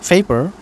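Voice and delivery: teacher models পেপার as fepar; English f sound. Shown as a demonstration. Teacher drilling students, পেপার said as fepar. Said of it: "পেপার, পারনা".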